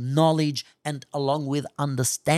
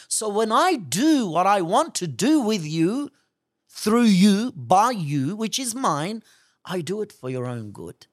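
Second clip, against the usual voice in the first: preaching crescendo; 5 semitones higher, 4 dB louder than usual. Real, not acted.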